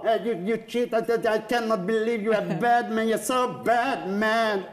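put-on high, broken Hungarian-accented English. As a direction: play the reporter in clumsy English. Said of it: "Próba".

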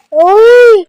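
very loud, high-pitched "oy!"; threatening yell. Real, not acted. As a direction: shout a real warning to back off, high and piercingly loud. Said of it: "నన్ను బెదిరిస్తుంది ఓయ్ అని".

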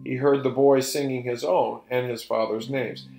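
ordinary delivery, nothing marked

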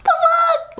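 a shocked, squeaky what-the; shocked disbelief. Real, not acted. Reacting to people who'd find it romantic, shocked squeal. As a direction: pitch leaps way up in disbelief.

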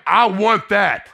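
slow, loud and yearning; drawn-out loud plea; longing cry. Retold or imitated. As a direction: reach out for it with your whole voice.

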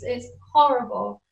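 ordinary delivery

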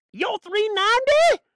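high, loud preacher's cry; ringing pulpit exclamation; exclaiming preacher. Real, not acted. First half of proclaimed exchange.

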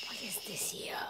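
hushed, voiceless question; whisper. Real, not acted. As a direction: whisper it very softly. Child whispers during wait.

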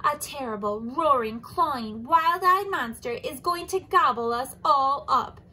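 loud frantic panic; breathless alarm. Acted. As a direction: gasp the warning out. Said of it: "panted Giraffe".

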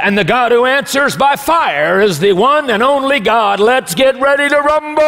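a mock ring announcer in a sermon; boxing ring announcer. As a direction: whip up the arena crowd before the bell. Announcer builds to ring call.

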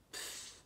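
soft puff of air through the lips; French huff. Shown as a demonstration. Teacher demonstrates the French sound, a huff. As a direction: blow out "pf" dismissively. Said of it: "o som da bufada".

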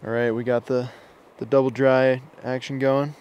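ordinary delivery

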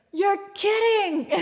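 high, loud, drawn-out disbelief; imitated huge relief. Lost driver learning his rescuer goes that way, overjoyed relief. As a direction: cry it slowly, high and laughing. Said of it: "you could just see the relief".

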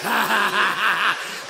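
a put-on laugh acted out for the scene; mock laugh. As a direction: laugh it off like you're hiding tears. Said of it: "and they gonna be like".

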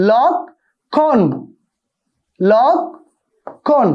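লক্ষণ drawn out very slowly as lokkhon; slow model pronunciation. Shown as a demonstration. Teacher to students, spelling out লক্ষণ very slowly. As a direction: stretch each sound of লক্ষণ so they can copy it. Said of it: "একটু ভালো করে খেয়াল করো".

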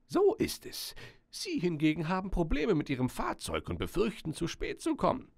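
put-on high-pitched old wizard voice; storybook magician, high voice; old magician character.

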